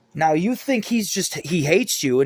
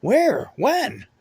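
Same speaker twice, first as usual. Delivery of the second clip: rising, baffled questions piling up; bewildered, each question climbing higher; a man waking up lost in a hospital; confused questions; 4 semitones higher, slower than usual. Retold or imitated. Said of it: "I'm like".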